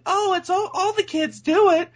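very high, squeaky mocking voice; mock excuse voice. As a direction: parody a dismissive excuse way up high. Mimicking the movie's excuse-making.

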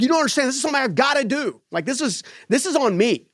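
high, urgent insisting; determined plea pitched high; insistent younger self. Retold or imitated. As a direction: push back hard, voice way up. Pleading with angry dad.

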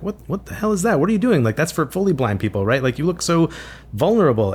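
high-pitched mock alarm; imitated shocked reaction. Husband imagining his wife's reaction to the cane, imitated alarm. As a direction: pitch jumps up, incredulous and protesting. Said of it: "she would have that reaction".

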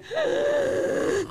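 sharp gasp. Retold or imitated. Exasperated at airport.

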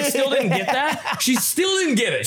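high, laughing near-shout; half-laughing, half-yelling in disbelief; cracking up while retelling the bartender mix-up. Real, not acted. Laughing outrage telling the story.

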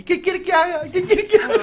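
very high crowing squawk; cartoon rooster, shrieking high. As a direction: crow the words like a rooster. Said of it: "y el gallo le dice".

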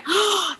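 re-enacted gasp of wonder; sharp intake of breath, as if awestruck; awed gasp. Retelling walking into the cathedral, awed gasp.

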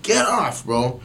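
high, loud retold jeering; heckling crowd. Crowd telling the last guy to get off, high loud imitated heckling. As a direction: holler at him like the fed-up crowd. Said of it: "we're like".

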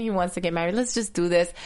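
normal talking voice

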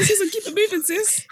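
high-pitched joking put-on voice; mock quote. Joking voice for the man.

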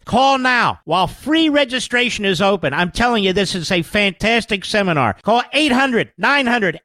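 loud, punchy sales pitch; ad read. Acted. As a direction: sell it hard to listeners. Reads a sponsor ad.

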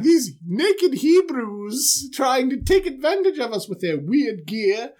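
mock-scandalized gossip; outraged townsfolk. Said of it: "They're like".

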